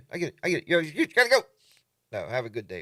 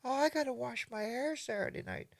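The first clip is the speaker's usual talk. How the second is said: much higher, put-on girly voice; girl's excuse; 12 semitones higher, 5 dB quieter than usual. Girl on the phone turning down a date, mock girly voice. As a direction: mimic a date brushing you off sweetly. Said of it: "she goes".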